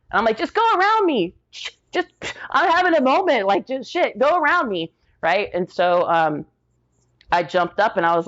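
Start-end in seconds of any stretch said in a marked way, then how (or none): 0.4-4.9 very high, strained voice; distraught plea. Retold or imitated. Crying to shoppers in the line, very high distraught plea. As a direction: beg them to step around, overwhelmed.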